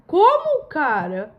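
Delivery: stunned indignant squeak; shocked disbelief. Real, not acted. Outraged at app's reply.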